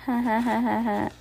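high, stagey gloating "ha ha ha"; put-on villain's cackle; villainous laugh. A narrator's reading. Villain sneers at the orphans' story, mocking laugh.

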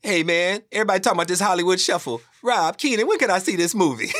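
high, loud, fast excited phone-message impression; Eddie Murphy. Star leaving a message about the movie, loud high impression. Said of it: "And it's Eddie".

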